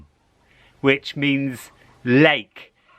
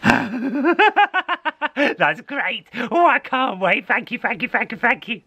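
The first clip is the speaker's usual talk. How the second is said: high, laughing thanks; gleeful cat; 10 semitones higher than usual. Acted. Cat thanking the old lady for the satchel, high and gleeful. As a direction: gush with greedy joy.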